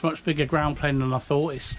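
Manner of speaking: ordinary talk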